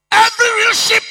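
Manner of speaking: loud, high, strained exclamation; preaching shout. Acted. Pastor to congregation, loud high preaching shout. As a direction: belt it out from the pulpit.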